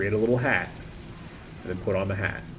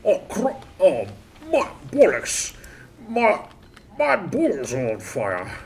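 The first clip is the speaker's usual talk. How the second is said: hammed-up Cockney with high, swooping pitch; Cockney British accent; 5 semitones higher, slower than usual. Streamer trying out Cockney to get more British, hammed-up Cockney accent. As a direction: exclaim like a cartoon Cockney in pain. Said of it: "What's a Cockney British accent? How's that go again?".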